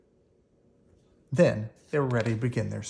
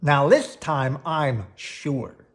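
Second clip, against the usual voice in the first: slow determined; 5 dB louder, much slower than usual. A narrator's reading. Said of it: "said Gwen, limping".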